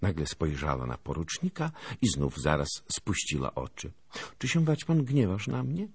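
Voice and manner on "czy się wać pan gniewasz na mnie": put-on high, coy girlish question. A narrator's reading. Anusia lowers her eyes before the lieutenant, coy. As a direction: ask sweetly in a high girl's voice. Girl coyly asks the lieutenant.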